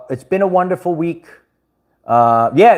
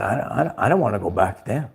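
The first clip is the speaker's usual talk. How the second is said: hushed; 6 semitones lower, 7 dB quieter than usual. Retold or imitated. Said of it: "I said".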